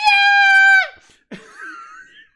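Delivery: very high, loud drawn-out scream; kicked kid's scream. Retold or imitated. Kid punted into the ether, long high scream. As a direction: scream like someone launched skyward. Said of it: "And he just went".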